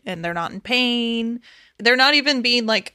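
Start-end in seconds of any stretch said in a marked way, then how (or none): none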